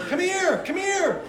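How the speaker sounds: local beckoning. Shown as a demonstration. Preacher shows how locals beckon, high sing-song call. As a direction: call someone over the way locals do. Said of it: "that's how they do it".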